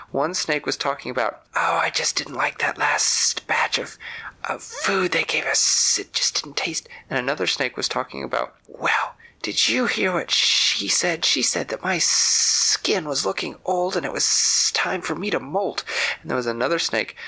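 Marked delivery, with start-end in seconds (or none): 1.5-6.9 slow, hissed whispery grumble; complaining snake. Acted. Zoo snake grumbling about its food, in a slow whispery hiss. 8.7-15.8 slow, whispery hissed gossip; gossiping snake. Acted. Zoo snake gossiping about its skin, in a slow whispered hiss.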